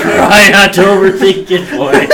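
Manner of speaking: mock the demon's line while cracking up; laughing imitation. Player mimics Etrigan's advice, laughing. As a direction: repeat the line through a laugh, loud. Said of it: "that Etrigan actually laughed".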